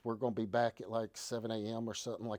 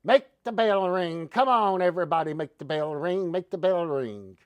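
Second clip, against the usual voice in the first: carnival barker; 4 semitones higher, 10 dB louder than usual. Pretend. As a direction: bark it out to the crowd at the fair. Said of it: "sounds like a damn, uh, carnival barker".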